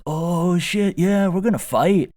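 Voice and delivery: high squeaky hyped voice; fired up. Retold or imitated. Rival iguana ready to fight, high hyped squeal. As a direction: squeal the challenge. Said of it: "The other guy's like".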